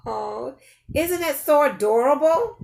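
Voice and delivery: plain reading voice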